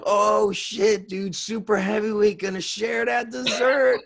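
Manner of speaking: high, loud teasing through laughter; laughing mockery. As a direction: rip into a friend, laughing. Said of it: "I ripped into him".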